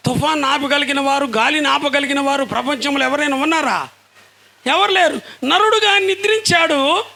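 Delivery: preaching that rises in pitch and force; impassioned preaching. Acted. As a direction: build from a rhetorical question to a high, ringing proclamation. Preacher builds to full force.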